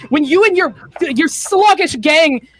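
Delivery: villain accuses Missus Hellfang, bitter; vengeful accusation. Acted. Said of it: "The villain's monologue".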